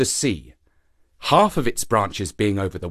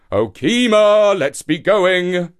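high, slow, drawn-out call; 6 semitones higher, 6 dB louder, slower than usual. A narrator's reading. Shark calls the silent monkey once more, calling out. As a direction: call up loudly, stretching the words. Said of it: "he called again".

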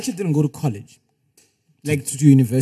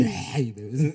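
phlegmy deep imitation; 5 semitones lower, 5 dB quieter than usual. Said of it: "It's better than your squeaky voice".

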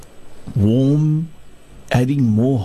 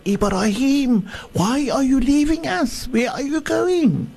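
high, pleading call after him; desperate shout; 13 semitones higher than usual. Acted. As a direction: cry out, begging him not to leave. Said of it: "she shouted to Ibra".

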